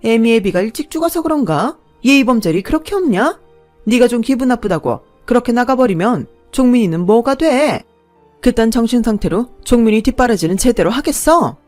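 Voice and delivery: a sneering mother-in-law berates the bride, loud; scornful scolding. A narrator's reading. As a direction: tear into her like a nasty mother-in-law.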